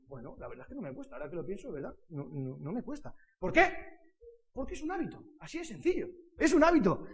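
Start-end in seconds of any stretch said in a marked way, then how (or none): 0.1-3.1 low, soft, musing answer; modest reply. Retold or imitated. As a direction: think it over quietly to yourself. His modest reply to the teacher. (respuesta modesta, baja y pensativa)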